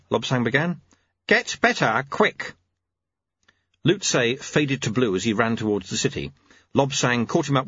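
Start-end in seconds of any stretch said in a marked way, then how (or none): 1.3-2.5 lightly voiced old man; terse old monk; old sweeper voice. Lutze voiced.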